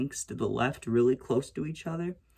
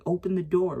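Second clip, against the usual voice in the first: high-pitched mock shout; retold yelling; 6 semitones higher, 4 dB louder than usual. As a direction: call through the door, insistent. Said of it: "I'm yelling. I'm like".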